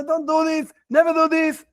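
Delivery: put-on high, urgent warning to the boy; scared father yelling. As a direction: shout it out of fear for his son. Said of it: "And you scream and you're scared".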